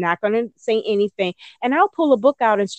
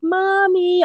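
put-on high little-boy call; child calling mommy; 12 semitones higher, 3 dB louder than usual. Son calling his mom, high and childlike. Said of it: "my son would be like".

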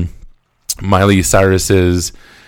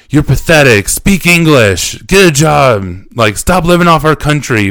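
higher, louder mock heckling; retold shouting; 6 semitones higher, 4 dB louder than usual. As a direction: imitate hostile hecklers shouting in your ear. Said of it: "just shouting this stuff".

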